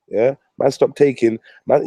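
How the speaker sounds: usual speaking voice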